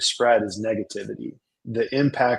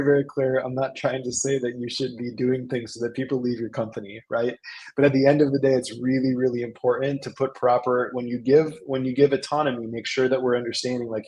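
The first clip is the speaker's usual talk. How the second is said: ordinary talk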